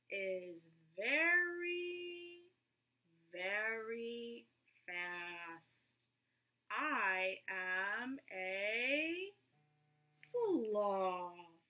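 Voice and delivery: very slow, sleepy sloth. A narrator's reading. Sloth answering Roy, very slow. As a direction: drag every word out lazily.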